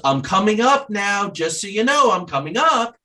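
high, sustained call up the stairs; pitched-way-up kid calling out, only moderately loud; retold child's yell. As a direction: imitate a little boy hollering a warning. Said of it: "And he yells".

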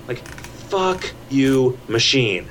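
normal talking voice

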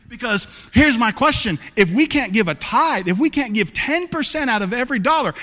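high, loud, fervent sermon preaching; preaching at full force. Acted. Pastor challenging his church family, preaching at full force. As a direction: drive it home to the congregation.

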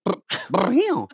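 high trilled drill noise; drill sound effect. Retold or imitated. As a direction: imitate short bursts of a power drill. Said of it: "what would he sound like".

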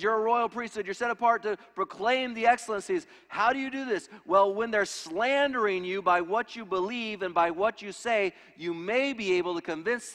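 high, loud, driving sermon voice; ringing pulpit proclamation; impassioned preaching. Acted. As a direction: preach it with fire. Preaching at full force.

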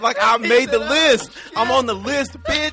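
high, loud, slow bragging; triumphant kid boast; hyped gloating. Retold or imitated. Gloating about the nice list.

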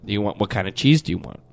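low imitation of a server's questions; Subway server. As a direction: rattle off the sauce options like a server. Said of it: "they will ask you".

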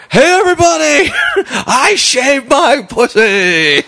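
mock high shouted announcement to the whole diner; a stripper shouting to the room, imitated high and loud; retold scream. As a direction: yell it out, hammed-up and laughing. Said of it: "she just screams to everybody there".

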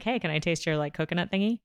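soft, hushed little request; quiet asking. Retold or imitated. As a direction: ask it under your breath. Acted quote asking friend.

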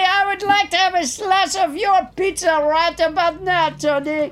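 mock French Trump voice; lightly French-accented Trump. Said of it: "French Trump".

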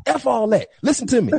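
imitating a fed-up coach, pitched high; mock scolding, cutting the player off; retold cussing out. Coach overruling a walk-on's objection, mock cussing out. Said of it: "he literally cussing at him".

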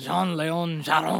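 loud copycat French accent; overdone French accent, slightly slow. Shown as a demonstration. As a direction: repeat "Jean-Léon Jérôme" in put-on French, loudly. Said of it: "Say it with a French accent".

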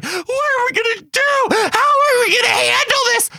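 put-on very high, loud squealing kid voices; panicking kids imitated. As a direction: shriek it like frantic five-year-olds.

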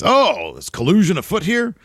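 high, loud mock alarm; Doc Hendricks. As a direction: put on a high-pitched announcer's outcry. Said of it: "Doc Hendricks, Michael P S Hayes, says".

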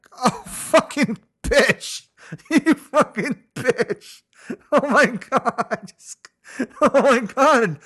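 high, slow sobbing wail with laughs; crying wail. Retold or imitated. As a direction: wail it out like you're breaking down. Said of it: "So I cried".

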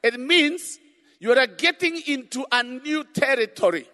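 loud fervent sermon; preaching. Real, not acted. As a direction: preach it with full force. Preaching at full force.

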